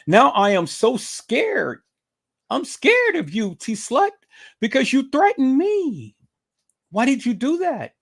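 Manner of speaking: high-pitched, whiny pretend fright; mock scared. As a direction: play the frightened victim, hammed up. Mock fear of the rival's threat.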